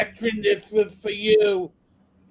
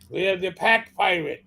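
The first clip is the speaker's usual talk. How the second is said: normal talking voice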